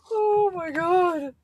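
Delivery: laughing. Real, not acted. Cracking up at the joke.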